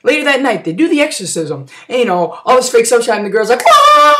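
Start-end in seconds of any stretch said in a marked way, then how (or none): 3.6-4.1 high, loud "wah" scream; shrieked imitation scream; mock scream. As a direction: wail out like the girl in an exorcism. Girl screams during fake exorcism.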